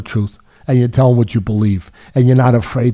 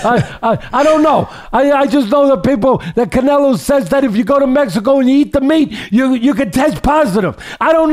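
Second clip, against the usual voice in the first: high, teasing mock-innocent rising to shouts; joking mock suspicion; 12 semitones higher than usual. Teasing British fans about the crumpets, high mock-innocent jokey voice. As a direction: playfully needle the fans, voice pitched way up. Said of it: "I'm just kidding".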